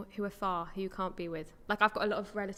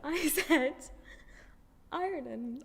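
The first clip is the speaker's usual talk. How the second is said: higher farmer's-accent "Ireland"; 6 semitones higher, much slower than usual. Real, not acted. Teased speaker repeating the word, farmer's accent. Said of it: "You said it in a farmer's voice".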